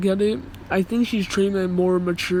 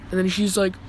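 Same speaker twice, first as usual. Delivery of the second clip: normal talking voice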